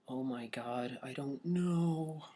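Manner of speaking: soft, drawn-out acted worry; hushed inner thought. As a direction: think aloud quietly, stretching the words. Acting out his own worried thought.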